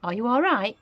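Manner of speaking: put-on boy checking on grandma; polite concern. A narrator's reading. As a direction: sound sweetly worried. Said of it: "George asked her politely".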